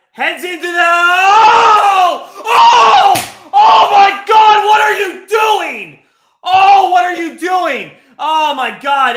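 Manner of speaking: screaming in disbelief at a near miss; shouted, high and loud, in disbelief; anguished shouting. Real, not acted. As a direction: yell it like your team almost conceded. Near-miss chance, watching live.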